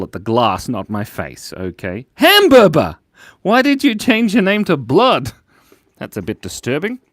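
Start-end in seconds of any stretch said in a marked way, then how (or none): 2.1-5.3 excited outburst. Real, not acted. Reading a funny chat name.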